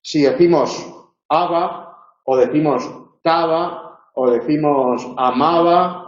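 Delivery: aba, taba, amaba with an open, soft B between vowels; soft b demo. Shown as a demonstration. Teacher showing the soft B between vowels. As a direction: lips not closing on the B. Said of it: "entre vocales".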